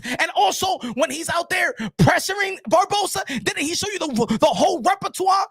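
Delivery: worked-up rant. Real, not acted. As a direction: fire off exasperated questions at the doubters, pitched high. Worked-up rant at other analysts.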